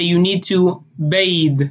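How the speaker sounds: "bathe" said as bayd, slow; Israeli bathe with D. Shown as a demonstration. Showing how Israelis say bathe, ending in D. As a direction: end "bathe" on a hard D. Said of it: "They would say".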